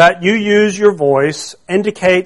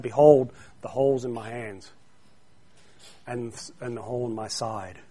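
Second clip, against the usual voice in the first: soft, reverent, much softer; hushed Jesus quote; 7 semitones lower, 13 dB quieter than usual. Acted. Jesus gently showing Thomas his wounds, softly.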